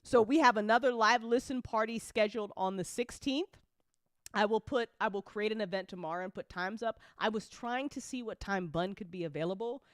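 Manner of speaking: ordinary delivery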